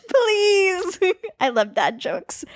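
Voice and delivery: high whiny plea; whiny begging. Acted. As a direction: beg like a kid. Begging for a joke segment.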